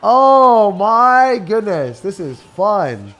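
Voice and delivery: high, slow, loud mock glee; hammed-up sarcastic joy; sarcastic exaggerated delight. Real, not acted. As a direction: overact the fun ironically. Sarcastic hyped reaction.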